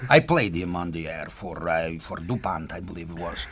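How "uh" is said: ordinary delivery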